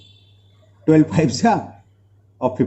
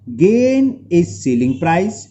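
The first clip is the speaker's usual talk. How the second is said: slow dictation; much slower than usual. Real, not acted. Teacher dictates formula slowly.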